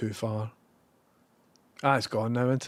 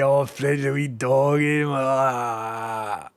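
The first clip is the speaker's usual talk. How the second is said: slow, louder cooing over the wee doggy; doting on dog; 4 dB louder, much slower than usual. Real, not acted. Streamer cooing over his dog Fred, doting. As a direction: coo like to a pet.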